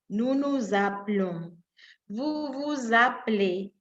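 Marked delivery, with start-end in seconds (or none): none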